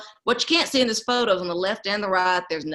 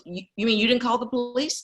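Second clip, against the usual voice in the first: surprised; 6 semitones higher than usual. Retold or imitated. Aunt's shocked reply.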